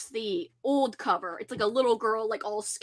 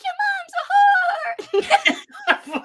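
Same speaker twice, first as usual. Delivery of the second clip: very high, slow, squeaky Smurf; high squeaky Smurf insult through laughter; 5 dB louder, much slower than usual. Retold or imitated. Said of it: "imagined them as Smurfs".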